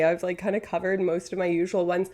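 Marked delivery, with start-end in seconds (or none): none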